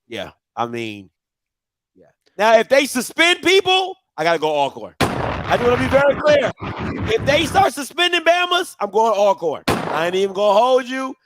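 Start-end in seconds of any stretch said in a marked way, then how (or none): 2.4-11.1 excited. Real, not acted. Joking threat to switch picks.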